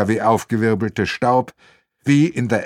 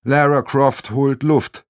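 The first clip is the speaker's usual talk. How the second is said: usual speaking voice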